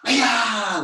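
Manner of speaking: loud, high martial arts kiai; sharp karate-class battle cry; loud yell. Shown as a demonstration. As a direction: yell it out high, falling away at the end. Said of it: "Loud yell".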